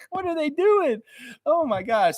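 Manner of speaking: high, disbelieving squeal of a question; can't believe what they just did; shocked, half-laughing disbelief; incredulous. Real, not acted.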